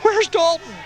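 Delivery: very high, loud screamed question. A narrator's reading. Woman in the panicking crowd, screaming for Dalton. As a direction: scream out for help. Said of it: "screamed a woman".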